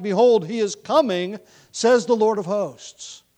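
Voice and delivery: loud, high, proclaiming prophecy; emphatic scripture reading. Acted. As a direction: proclaim the verse forcefully. Reads scripture at full force.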